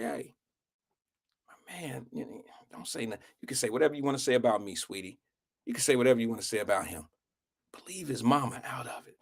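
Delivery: hushed, low, quick asides; whispered over. Retold or imitated. Friend discreetly asking a girl to spare the mother, very soft. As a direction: nicely let her know, barely above a whisper. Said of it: "I just whispered over to her".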